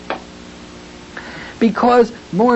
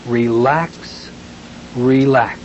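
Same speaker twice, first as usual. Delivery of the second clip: gentle relax; 5 semitones lower, much slower than usual. Shown as a demonstration. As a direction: soothe them down with each word. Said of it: "gently say the words".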